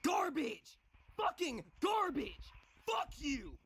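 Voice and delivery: high-pitched angry shouts; furious cursing. Real, not acted. Gamer cursing the game as garbage, furious cursing.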